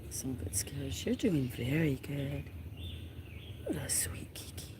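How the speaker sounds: soft whisper to a scared kitten. Real, not acted. Owner soothing a scared kitten in a whisper. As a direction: hush it down.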